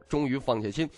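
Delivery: ordinary delivery, nothing marked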